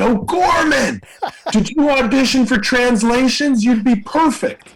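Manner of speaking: high-pitched holler from a passing truck; excited high-pitched shout across the street; calling out. Retold or imitated. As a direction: call out to a friend from a moving fire truck.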